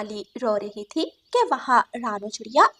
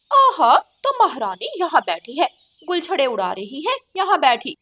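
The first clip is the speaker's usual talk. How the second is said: loud, higher, sneering fury; shouting sister-in-law; 4 semitones higher, 7 dB louder than usual. Acted. Sister-in-law catches Kali talking, shouts her home. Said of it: "ऐसे क्यों चिल्ला रही हो".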